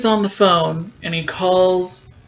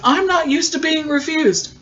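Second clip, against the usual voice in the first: much higher, hammed-up wounded pride; mock offended villain; 10 semitones higher than usual. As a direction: squeak out the villain's outrage. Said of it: "And he goes".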